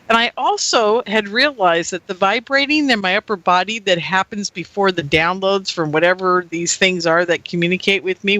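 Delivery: ordinary talk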